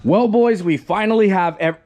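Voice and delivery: hoarse, losing voice; rough, fading voice. Real, not acted. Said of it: "my voice is going out".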